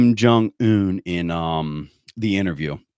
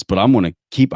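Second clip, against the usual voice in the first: usual speaking voice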